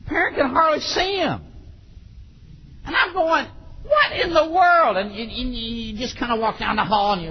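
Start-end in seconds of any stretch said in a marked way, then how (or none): 3.8-5.0 high, drawn-out incredulous cry; exasperated disbelief. Acted. Preacher watching parent argue with child, exasperated. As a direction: stretch it out, utterly baffled.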